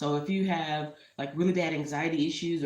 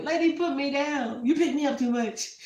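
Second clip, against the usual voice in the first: high, put-upon cat complaining; annoyed cat voice; 10 semitones higher, 5 dB louder than usual. Pretend. Her cat complaining to her, high and put-upon. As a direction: voice the cat, fed up with being held. Voicing her cat's thoughts.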